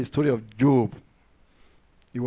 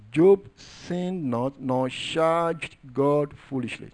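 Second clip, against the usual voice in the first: solemn slow reading; much slower than usual. Acted.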